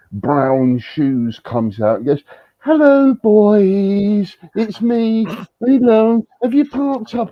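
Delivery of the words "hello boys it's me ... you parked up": high, loud sing-song camp welcome; camp host greeting. Retold or imitated. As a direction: imitate the flamboyant host's hello, pitched way up.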